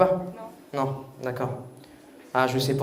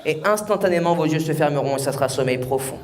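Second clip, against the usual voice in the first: hypnotic voice; 6 semitones lower than usual. Acted. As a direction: lull them toward deep sleep. Hypnotist suggests deep sleep.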